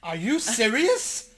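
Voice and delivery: high, loud, stretched-out disbelief; loud incredulity. Real, not acted. Husband to wife struggling to name a sacrifice, loudly incredulous. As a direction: blurt it out high and drag it out. Incredulous at wife's slow answer.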